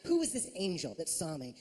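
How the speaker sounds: ordinary talk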